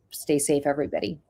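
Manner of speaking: normal talking voice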